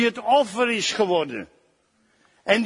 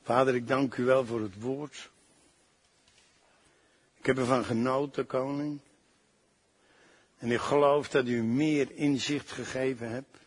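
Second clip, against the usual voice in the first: softer, lower, intimate prayer voice; 10 semitones lower, 7 dB quieter than usual. Acted. Preacher thanking God for the word, soft prayer. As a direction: pray quietly and gratefully.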